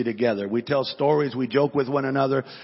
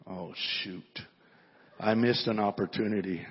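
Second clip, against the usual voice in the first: low, hushed dawning regret; quiet regret; 7 semitones lower, 8 dB quieter than usual. Retold or imitated. Pastor realizing he missed his chance to pray, hushed regret.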